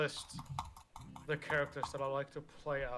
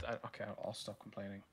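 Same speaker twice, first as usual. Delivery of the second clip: quiet disappointed sighing; 6 semitones lower, 8 dB quieter, much faster than usual. Real, not acted. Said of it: "You sound like a disappointed dad".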